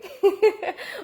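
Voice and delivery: high bursting laugh; happy laugh. Real, not acted. Laughing to the audience during her check-in. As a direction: let a real laugh break out high.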